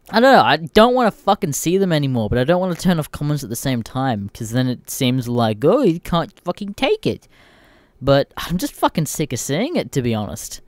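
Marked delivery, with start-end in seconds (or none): 5.6-7.3 sneering mock commenter voice, higher. Mimicking a smug commenter's jeer.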